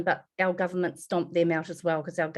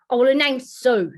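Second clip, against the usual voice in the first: gruff correction; 5 semitones higher, 8 dB louder than usual. Retold or imitated. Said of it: "She sounds so attractive".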